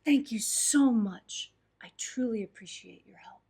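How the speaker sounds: put-on old guru's humble whisper; old monk whispering his thanks. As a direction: hush it like a gentle old master. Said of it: "the old man looked at him and said".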